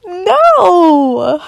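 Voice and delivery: sudden loud startled no; shocked shout. Real, not acted. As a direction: blurt it out in shock. Reader shocked by the story.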